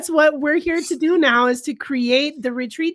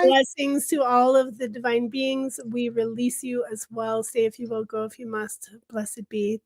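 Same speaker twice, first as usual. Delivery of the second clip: soft, hushed benediction; gently intoned parting blessing, fading softer; closing blessing; 6 dB quieter than usual. Real, not acted. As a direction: send them off with a quiet blessing.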